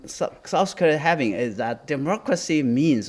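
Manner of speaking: ordinary talk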